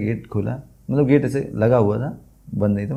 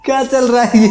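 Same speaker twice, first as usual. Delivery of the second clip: higher, louder, words through laughter; laughing; 10 semitones higher, 4 dB louder than usual. Real, not acted. Telling friend of the manager's jibe, laughing. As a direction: laugh in disbelief as you say it.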